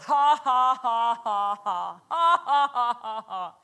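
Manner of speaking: deliberate "ha ha ha", punched then falling away; forced laugh. Retold or imitated. Preacher imitates a man answering the devil, forced laugh. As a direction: fake a laugh you don't feel. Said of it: "I forced myself to laugh".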